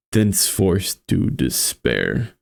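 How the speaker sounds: ordinary delivery, nothing marked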